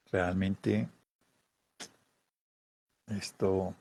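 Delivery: very soft, inward murmur; withdrawn sadness. Retold or imitated. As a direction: turn inward, barely voicing it. (tristeza hacia adentro, muy suave)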